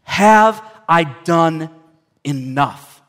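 very slow, word by word; drawn-out, weighty inner doubt; fearful self-questioning. Acted. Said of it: "he had this fear".

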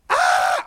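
sudden high loud scream; terrified scream. Retold or imitated. Body falls out of the closet, audience screams in terror. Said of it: "in sheer terror".

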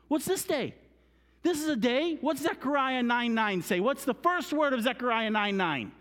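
high, loud, urgent questioning; rousing full-force preaching. Real, not acted. Teacher urging children to find the verse, high and loud.

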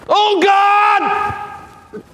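very high, drawn-out cry to God; praying out loud. Acted. David praying aloud in a cave, loud high cry. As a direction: cry out to heaven at full voice. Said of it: "this powerful voice. He begins to pray".

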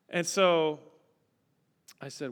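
usual speaking voice

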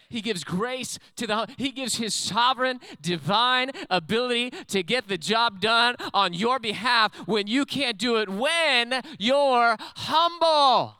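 loud, high sermon climax; full-force preaching. Real, not acted. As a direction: build to a long, drawn-out shout.

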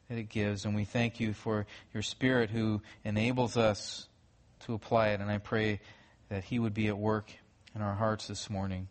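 soft, low, unhurried prayer voice; hushed pulpit prayer. Acted. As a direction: pray quietly and reverently. Pastor prays before sermon.

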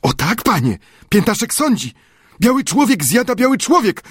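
high zealous servant voice; eager Friday voice. A narrator's reading. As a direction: press it eagerly, pitched high. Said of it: "odparł z zapałem".

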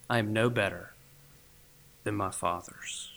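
soft, low, defeated confession; acted dejection. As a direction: sink into the prophet's sense of failure, with heavy pauses. Voices Elijah's dejection after complaint.

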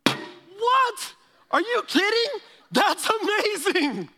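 very high, loud astonishment; high-pitched amazed outburst, slowing on amazing. Retold or imitated. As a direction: squeal with disbelief and delight. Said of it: "And I go".

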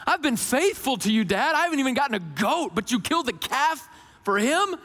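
put-on resentful older son. Voicing the resentful older brother.

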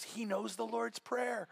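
hushed, amazed realization, slowly; soft surprised aside. Retold or imitated. Punchline aside retold softly.

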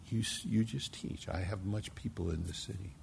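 soft, low and tender; soft voice of the Lord. Retold or imitated. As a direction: hush it down, as God's quiet word. The Lord speaking to the preacher.

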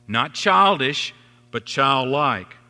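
put-on slow, weight on "childish" and "childlike"; slow contrast. Preacher contrasting "childish" and "childlike", slow and deliberate. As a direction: lean hard on "childish" then "childlike".

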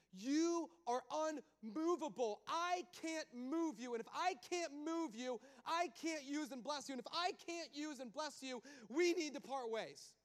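God telling Moses they must part ways; God insisting. Acted. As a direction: punch each I can't, God giving up on Moses. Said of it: "And you know what God says".

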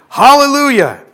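big, loud shout of praise; roaring scripture proclamation; thunderous crying out. A narrator's reading. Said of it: "like the roar of many waters".

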